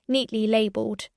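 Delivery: usual speaking voice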